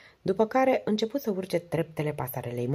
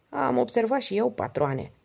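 higher-pitched, lightly hoarse reply; 8 semitones higher than usual. A narrator's reading. Harry answers Don after takeoff, hoarse. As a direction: answer the boss in a higher, slightly husky voice. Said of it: "răspunse Harry cu voce răgușită".

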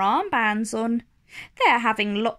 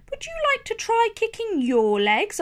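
Mummy Pig; 9 semitones higher, faster than usual. Acted. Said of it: "asks Mummy Pig".

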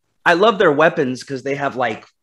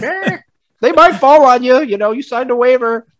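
much higher, breezy hammed-up official voice; mocking the organizers as a breezy, much higher carnival barker; mock carefree organizer; 11 semitones higher, 4 dB louder than usual. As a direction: play a careless arena boss who shrugs it off. Mocking careless arena organizers.

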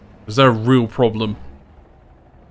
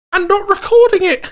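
very high squeal into laughter; laughing wail. Real, not acted. Player wailing at not recording, breaking into laughter. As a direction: despair dissolving into giggles.

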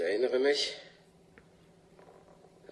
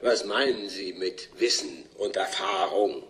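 usual speaking voice

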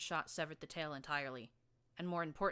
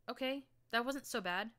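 said with a laugh; 7 semitones higher, 5 dB louder than usual. Acted. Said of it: "I said with a laugh".

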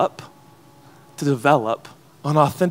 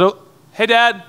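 higher, louder call to a father walking off; calling out to dad; 6 semitones higher, 6 dB louder than usual. Retold or imitated. Boy calling to his dad at the door, raised call. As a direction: call out to dad across the room. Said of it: "I said".

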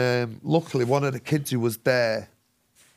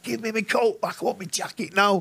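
much higher, mock furious demand; 7 semitones higher than usual. Thrown-out man yelling at the bouncers for his jacket, mock furious. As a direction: bang on the door demanding your coat. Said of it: "I'm like".

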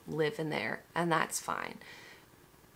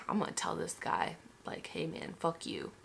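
whispered fantasy insult to the seller; a joking whisper, much softer; whispered mock threat; 8 dB quieter than usual. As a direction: whisper the fuck you like a secret plan. Imagined telling off, whispered.

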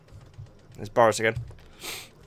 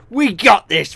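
louder rallying cry; pumped up; 3 semitones higher, 7 dB louder, slower than usual. Real, not acted. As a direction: hype yourself up.

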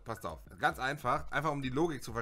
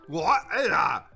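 ordinary talk

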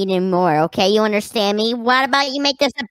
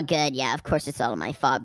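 creepy low murmur; 11 semitones lower, 9 dB quieter than usual. Acted. Creepy sister taunts again.

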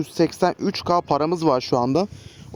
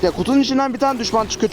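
much higher, annoyed complaint; irritated outburst; 8 semitones higher than usual. Real, not acted. Gamer annoyed by an enemy popping out of a box, irritated. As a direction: let the irritation jump up in pitch.

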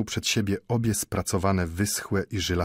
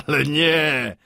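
acted laugh into a higher, louder reply; 7 semitones higher, 6 dB louder, slower than usual. A narrator's reading. Watchmaker dismisses old Mendel's question, laughing. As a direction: laugh off the suggestion, loudly. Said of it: "roześmiał się zegarmistrz".

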